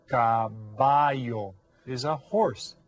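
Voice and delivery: caballo is a horse, said slowly; slow model caballo. Shown as a demonstration. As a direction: stretch out caballo for learners. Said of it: "Can everybody say that?".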